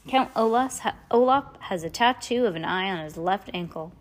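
ordinary delivery

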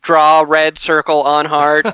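slow, monotone machine instruction; evenly spaced robot words. Acted. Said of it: "a robot voice".

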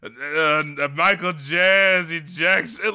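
slow, drowsy mumbled name list; sleepy, slowed-down shout-outs; falling asleep. Retold or imitated. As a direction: drag the names out like you're nodding off. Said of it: "he just sounds like this guy is just slowly falling asleep".

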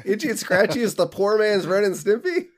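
high, loud burst of disbelief; incredulous. Real, not acted. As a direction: blurt it out, real shock at the claim. Reacts to co-host's odd comparison.

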